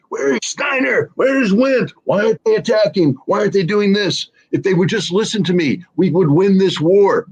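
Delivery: high, ranting Hitler imitation; mock the Downfall bunker rant, pounding the table; hammed-up frantic dictator rant. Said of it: "Hitler's sitting there and, you know, he's pounding the table".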